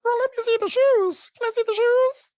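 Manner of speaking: extremely high-pitched caricature voice; Heavenly. Retold or imitated. Heavenly asking Jackie to show shoes, mock high-pitched voice. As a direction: squeal it like a mock sweet lady. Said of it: "I'm just in my high-pitched voice".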